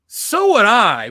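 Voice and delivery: slow, high and loud; retold reply, drawn out with gusto; emphatic agreement. Retells own emphatic reply.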